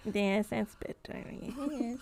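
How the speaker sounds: odd creature voice with drawn-out, swooping "yes"; Nanoxus. Acted. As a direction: stretch the final words in a creepy lilt. Game master plays Nanoxus.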